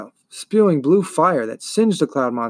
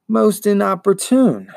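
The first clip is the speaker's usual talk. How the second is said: slow, high, irritated creature voice; angry buzzing monster; 6 semitones higher, 4 dB louder, slower than usual. Acted. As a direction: draw it out as an annoyed swarm. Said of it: "said the angry buzzing".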